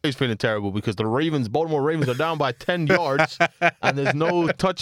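bluffing sports commentator voice; mock commentary. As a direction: ham up a clueless pundit.

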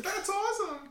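squeaky high happy chant; silly high cheer. Pretend. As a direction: cheer like a tiny cartoon fan. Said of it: "happy and sad about our teams".

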